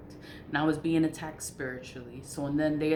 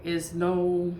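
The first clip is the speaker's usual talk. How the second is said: ordinary delivery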